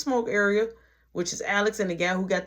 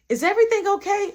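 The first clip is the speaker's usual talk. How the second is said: concerned boss; 13 semitones higher, 6 dB louder, faster than usual. Retold or imitated. Manager checking on upset worker.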